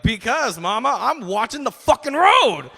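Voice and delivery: high, loud mock outburst; exasperated shout. Son answering his driving mom, exasperated yell.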